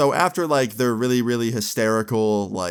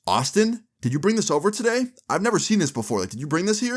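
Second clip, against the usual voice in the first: high, questioning dad voice; acting out a suspicious father; retold interrogation; 9 semitones higher, faster than usual. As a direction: press for an answer, pitch raised.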